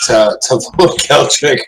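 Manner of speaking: ordinary delivery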